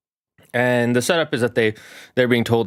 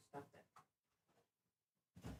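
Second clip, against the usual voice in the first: whispered scolding; 37 dB quieter than usual. Real, not acted. Scolding cat.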